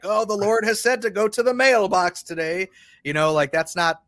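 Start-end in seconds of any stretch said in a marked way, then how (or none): 0.0-2.7 higher, louder mock-holy proclamation; mock pious announcement. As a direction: parody a showy believer announcing a sign from God. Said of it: "I'm not like".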